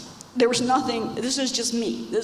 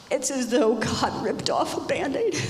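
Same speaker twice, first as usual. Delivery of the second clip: tearful; 5 semitones higher than usual. Real, not acted. Testimony of painful loss.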